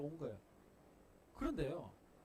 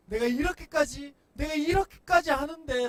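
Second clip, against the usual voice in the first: much louder, higher repetition; building outcry; 12 semitones higher, 19 dB louder than usual. Pretend. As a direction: escalate into near-shouting. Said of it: "되뇌일 수 있을 거예요".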